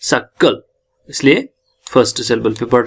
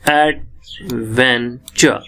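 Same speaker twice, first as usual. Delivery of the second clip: "Adventure" slowly broken into ad, ven, chuh; wide gaps between the syllables of "adventure"; 3 semitones lower, much slower than usual. Shown as a demonstration. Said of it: "तीन syllable". ("Adventure" तोड़-तोड़कर, धीरे)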